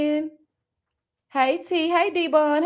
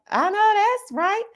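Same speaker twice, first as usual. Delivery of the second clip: high sing-song; 9 semitones higher, slower than usual. Real, not acted. Repeats catchphrase higher.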